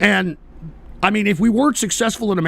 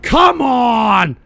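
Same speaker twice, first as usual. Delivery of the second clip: exasperated shout; 5 semitones higher, 4 dB louder than usual. Real, not acted. Exasperated at a crude chat comment.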